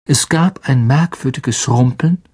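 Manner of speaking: ordinary delivery, nothing marked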